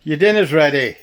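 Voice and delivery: loud high call across the house; yelling across the room. Real, not acted. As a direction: shout it to someone in another room. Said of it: "excuse me while I shout for my son".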